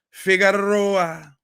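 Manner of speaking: slow, drawn-out "Figueroa"; dramatic name call. Acted. Host introducing the next clip, drawn-out name. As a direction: stretch the name like a ring announcer.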